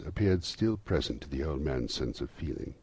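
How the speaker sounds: ordinary delivery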